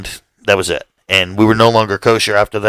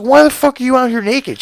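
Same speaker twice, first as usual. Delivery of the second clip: much higher, exasperated mock shriek; outraged shriek; 14 semitones higher than usual. Homeowner demands why the roommate is naked, exasperated. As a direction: squeal the question in disbelief.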